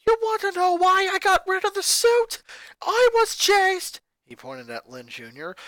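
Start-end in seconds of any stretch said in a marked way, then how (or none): 0.0-4.0 put-on high, loud furious boy shouting at family; a boy exploding at his mother; angry boy ranting. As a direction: snap back at Mom, furious. Lincoln's angry rant.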